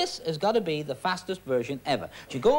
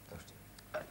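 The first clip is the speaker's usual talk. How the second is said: whispered prompt; 22 dB quieter than usual. Acted. Magician prompts the answer.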